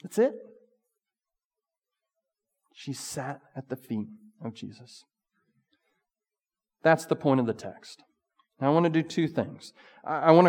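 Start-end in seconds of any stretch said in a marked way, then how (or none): 2.7-5.0 much softer, almost breathed; near whisper. Acted. Preacher repeats Mary's devotion to the congregation, near whisper. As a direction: breathe the words out to the room.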